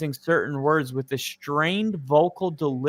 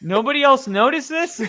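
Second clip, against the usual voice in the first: high, loud incredulous question through laughter; laughing disbelief; 9 semitones higher, 5 dB louder than usual. Real, not acted. Co-host amazed nobody noticed the coach's voice, laughing in disbelief. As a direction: ask it incredulously, cracking up.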